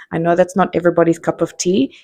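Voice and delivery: ordinary delivery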